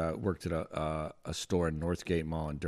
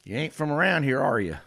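put-on Southern accent; 7 semitones higher, 5 dB louder than usual. Stranger questions a man in the parking lot, Southern accent. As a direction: play the Southern stranger sizing someone up. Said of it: "That dude's Southern accent".

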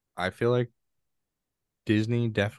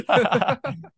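high burst of laughter; 6 dB louder than usual. Real, not acted. Laughing at the forgotten name.

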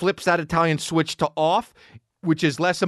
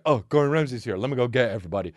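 lower, timid voice of cowed staff; meek, sheepish staff; 7 semitones lower, faster than usual. Retold or imitated. Staff meekly obeying the chef, lower and sheepish. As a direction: shrink into meek compliance. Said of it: "everyone's just like".

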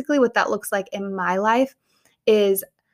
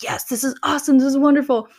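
higher, mildly elated acted reaction; mock delight; 5 semitones higher, 3 dB louder, faster than usual. Believer reacting to hearing God speak, mock delight.

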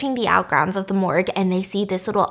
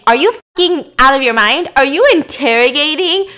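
louder and higher, climbing to a near shriek; a furious boss losing it on the phone; boss yelling; 7 semitones higher, 9 dB louder than usual. Retold or imitated. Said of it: "it's his boss yelling at him".

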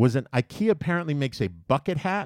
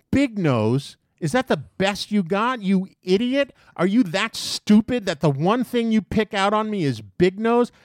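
higher-pitched, incredulous retold rant; going off on him; 7 semitones higher, 3 dB louder than usual. Man tears into the kid over his insult, indignant rant. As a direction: throw the insult back in mock outrage. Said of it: "I start going off on him".

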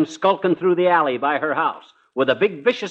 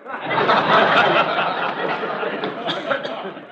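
hearty laugh; 4 semitones higher than usual. Acted. Laughs at the cop.

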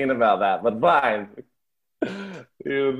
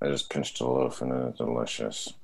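low, soft, lifeless line read; dead soul voice; 9 dB quieter than usual. Acted. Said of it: "that was my happy bread voice".

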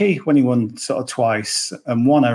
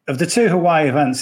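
ordinary talk

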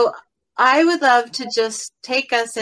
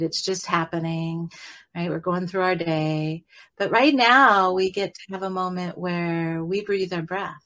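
guided meditation. Acted. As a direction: guide listeners into calm breath. Leads a guided breathing meditation.

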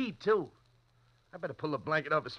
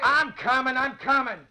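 loud high call back; answering a distant call; 8 semitones higher, 6 dB louder than usual. Acted. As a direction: shout across to him. Calling back to distant janitor.

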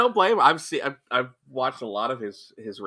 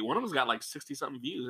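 ordinary delivery

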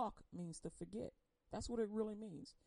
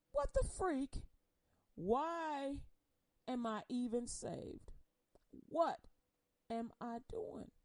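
frustrated questioning, pitched up; exasperated outburst; 4 semitones higher, slower than usual. Acted. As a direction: cry out in exasperation, then drag the words. Voicing exasperation with her faith.

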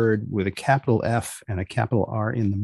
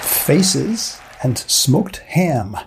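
slow dramatic title read; 4 semitones higher, much slower than usual. Acted. Podcast opening title announcement.

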